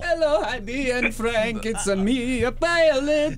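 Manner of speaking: high, slow mock Indian-reggae accent; hammed-up sing-song Middle Eastern reggae voice. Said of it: "It's your Middle Eastern reggae".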